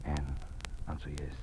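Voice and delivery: hushed aside whisper. Acted. Dean secretly prompting the new teacher, whispered. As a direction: slip her the answer under your breath.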